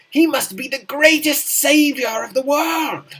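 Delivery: loud, high rapturous proclamation; grand exclamation. Acted. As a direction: hail him like a saviour. Exclaiming as the Beaver Monarch.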